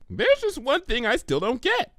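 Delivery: high, fast, eager pony voice; bubbly Pinky. Acted. Pinky suggesting to Twilight, bubbly and quick. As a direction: blurt out a silly idea brightly.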